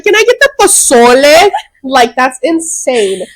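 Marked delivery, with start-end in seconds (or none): 0.0-1.5 "pozole" as poh-SOH-leh, voice pitched high; overdone Spanish vowels on "pozole"; Spanish pronunciation. Retold or imitated. Mocking her own restaurant order, "pozole" said the Spanish way. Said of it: "wanna be able to say the words".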